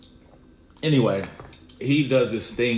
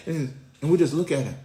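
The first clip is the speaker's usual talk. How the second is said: usual speaking voice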